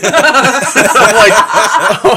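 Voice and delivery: high, loud laughing "Oh my goodness". Retold or imitated. Recalling the creepy trailer scene, laughing high and loud. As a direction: squeal it through laughter.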